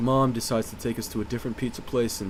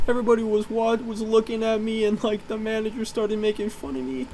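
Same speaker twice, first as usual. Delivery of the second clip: high, whining self-pitying voice; whiny mom; 11 semitones higher than usual. Retold or imitated. Crying mom complaining to the boys about the manager, high and whiny. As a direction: whine it like a wronged mother.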